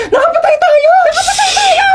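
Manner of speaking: very high, wailing shriek; panicked crying shouts; hysterical panic. Acted. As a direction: scream in comic horror. Thinks they have killed someone.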